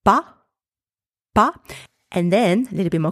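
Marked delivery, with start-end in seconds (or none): 0.1-1.5 "pa" alone, open a, lifted and cut short; partial syllable. Shown as a demonstration. Teacher builds the syllable for learners, partial syllable. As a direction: sound out "pa" with a raised pitch, then repeat. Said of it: "So up to here we have".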